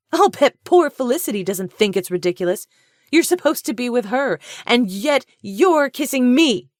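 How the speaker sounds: high, loud, slowing accusation; escalating fury. A narrator's reading. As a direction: drive home each word in anger. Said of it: "Her voice escalated".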